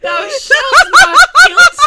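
slow, high, loud line broken by laughter; laughing through it. Real, not acted. Repeating the kilt slip, helpless laughter. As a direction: quote it while cracking up.

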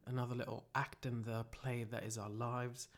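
low, soft self-help voice; hushed, earnest mantra leader; mock guided reflection. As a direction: gently guide them into solemn reflection.

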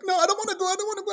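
very high, panicky little protest; mock reluctant refusal. Bridesmaid refusing to go up there, high-pitched protest. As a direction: squeal the refusal like a reluctant helper.

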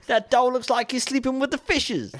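high-pitched hammed-up American mobster; American mafia accent. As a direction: do a badly faked American gangster. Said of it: "there's only two American accents I can do".

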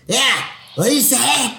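high-pitched, shouty little henchman; mock sidekick. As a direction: imitate the minion backing up his boss. Hunched sidekick backs up neighbor.

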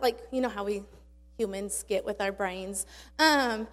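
normal talking voice

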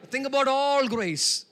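slow, loud, ringing preacher proclamation; preaching at full force. Acted. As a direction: stretch the verse out loud like a pulpit cry. Preacher exhorts.

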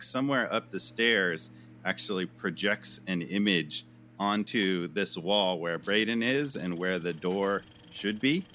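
ordinary delivery

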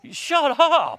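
very high, loud shout of shut up; God yelling at him to stop. Retold or imitated. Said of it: "God's going".

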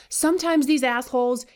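normal talking voice

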